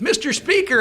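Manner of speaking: high, loud hail; calling out. Retold or imitated. Spotting the retired Speaker with his entourage, calling out. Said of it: "and I went like this".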